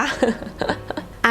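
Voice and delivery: high, light giggle; self-mocking giggle, softly let out. Real, not acted. Teacher admitting she drives very badly, high soft giggle. Laughs after admitting bad driving.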